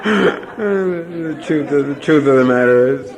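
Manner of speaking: laughing. Real, not acted. Laughing through the words.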